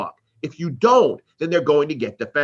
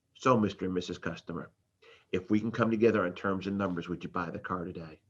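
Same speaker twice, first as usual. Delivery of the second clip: salesman's closing question; 8 semitones lower, 10 dB quieter than usual. Acted. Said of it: "we hit them with this question".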